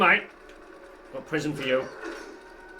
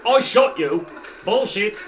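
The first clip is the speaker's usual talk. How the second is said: indignant; 6 semitones higher, 7 dB louder than usual. Real, not acted. Enemy survives a shot.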